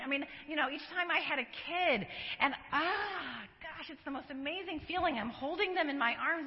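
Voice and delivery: ordinary delivery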